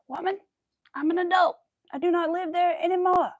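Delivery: high, loud, laughing protest; exasperated outburst. Real, not acted. Telling off her mother over the phone call, loud and exasperated. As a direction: fire back at an overbearing mom.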